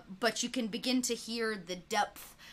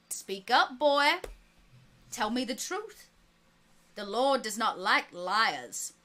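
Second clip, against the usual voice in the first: stern preacher; 3 semitones higher, 6 dB louder than usual. Acted. Preacher presses the boy.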